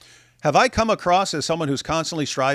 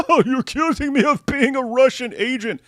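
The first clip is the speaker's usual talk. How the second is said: mock indignation; 8 semitones higher, 3 dB louder than usual. Mocking Andrew's indignant reply.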